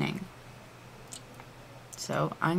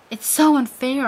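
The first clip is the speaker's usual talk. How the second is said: higher, louder whiny outburst; hammy stage actor; 7 semitones higher, 4 dB louder than usual. Acted. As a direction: wail it like a melodramatic teen. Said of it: "I yelled".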